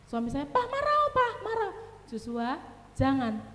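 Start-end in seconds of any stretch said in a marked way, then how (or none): 0.5-1.7 put-on high-pitched little boy begging; child's plea. Mother imitating son Joshua asking his papa to get angry, high childish plea. 2.1-3.4 soft, meek telling-off with no bite; too-gentle scolding. Retold or imitated. Wife imitating husband's toothless scolding of son Joshua, soft and meek. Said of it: "Oh, gitu toh marahmu. Kok beda ya?".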